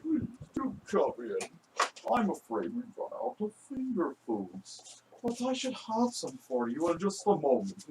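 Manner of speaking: soft, high sinister butler voice; Skeeves the Butler. Acted. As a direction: play a creepy polite butler, quietly. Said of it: "pretty good Skeeves the Butler impression".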